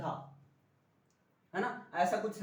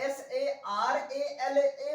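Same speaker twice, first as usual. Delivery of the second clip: slow spelling; 3 semitones higher, much slower than usual. Shown as a demonstration. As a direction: spell एस ए आर ए एल ए slowly, letter by letter. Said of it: "पहले आप दो पर जोर दे रहे हो". (धीरे धीरे अक्षर बोलना)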